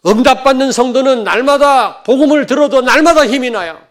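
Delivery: loud and high, ringing out; preaching at full force. Real, not acted. Pastor exhorting his congregation, loud and high. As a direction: proclaim it to the whole congregation.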